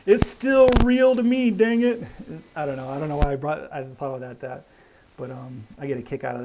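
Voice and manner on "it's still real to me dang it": imitating a tearful middle-aged fan, higher and louder; hammed-up sobbing protest, slow and strained; crying wrestling fan. Meme fan insisting wrestling is real, mock crying. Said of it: "this middle-aged man is, like, crying".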